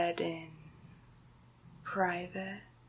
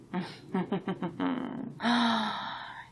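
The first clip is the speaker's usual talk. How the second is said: long closed-mouth villain chuckle, then a breathy sigh; self-satisfied evil snicker; gloating chuckle; 3 dB quieter than usual. Acted. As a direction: chuckle with relish at your own scheme. Villain relishing coming power.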